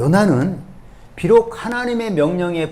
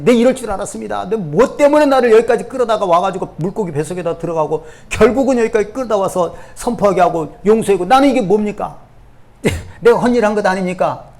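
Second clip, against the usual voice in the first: loud, aggrieved protest; indignant rant; 5 semitones higher, 4 dB louder than usual. Retold or imitated. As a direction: complain as if used and cheated. Jonah rants at God.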